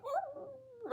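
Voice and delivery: high, soft pitiful whine; put-on pitiful "oh"; fake dog whimper. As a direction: whimper like a dog faking a limp. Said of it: "them dogs who be, like, limping".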